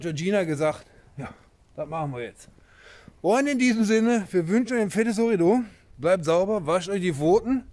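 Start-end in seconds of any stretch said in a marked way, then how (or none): 3.2-7.6 loud cheerful sign-off. Real, not acted. Sign-off sending greetings.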